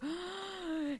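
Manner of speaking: soft high gasp; a soft, delighted intake of breath; mock gasp. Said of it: "I'm like".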